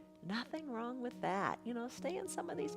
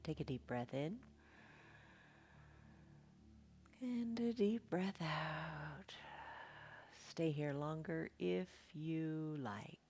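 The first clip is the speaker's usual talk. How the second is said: soft spa whisper; 2 semitones lower, 7 dB quieter than usual. Acted. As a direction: whisper gently like a meditation guide. Whispered breathing cues.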